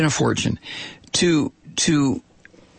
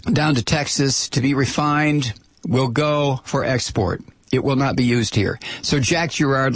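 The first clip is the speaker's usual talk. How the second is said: usual speaking voice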